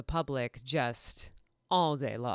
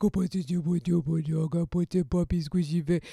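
put-on baby talk; 3 dB louder than usual.

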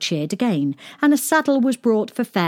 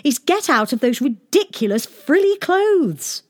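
high, loud bossy princess; cartoon princess; 8 semitones higher, 4 dB louder than usual. A narrator's reading. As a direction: order them out of frills. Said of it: "said the princess".

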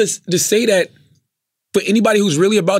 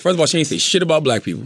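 ordinary talk

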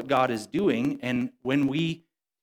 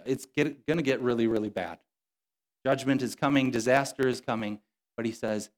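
ordinary talk, nothing marked

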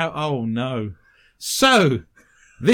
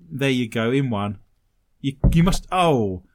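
ordinary delivery

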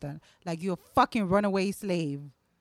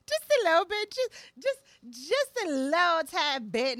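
coy high voice; 8 semitones higher than usual. Shown as a demonstration.

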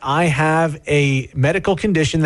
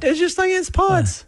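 much higher, dopey voice; squeaky know-nothing reply; mock clueless answer. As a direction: play dumb about the pods. Mock dumb answer about pods.

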